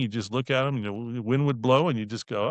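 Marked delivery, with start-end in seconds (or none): none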